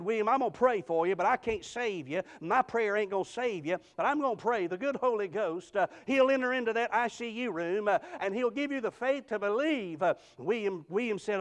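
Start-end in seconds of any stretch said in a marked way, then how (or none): 0.0-10.0 raised, driving preacher's delivery; fervent preaching. Real, not acted. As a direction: pour out scripture in an urgent pulpit cadence. Preaches at full voice.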